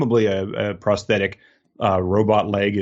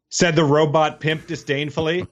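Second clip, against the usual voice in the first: punchline; 6 semitones higher than usual. Acted. Punchline after tender Salinger quote.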